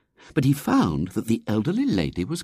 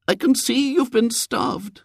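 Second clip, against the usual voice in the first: very high, slow lady's voice; pitying lady; slower than usual. A narrator's reading. Lady watches William eat, pitying and high. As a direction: draw it out with high-pitched pity.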